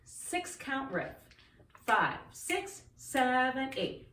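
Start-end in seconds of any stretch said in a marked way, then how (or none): none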